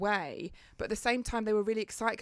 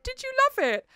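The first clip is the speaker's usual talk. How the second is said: much higher, loud, chirpy imitation; Paige's excited question; 6 dB louder than usual. Friend at the bottom of the slide, asking with mock glee. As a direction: mimic a friend bubbling with glee. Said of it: "Paige went".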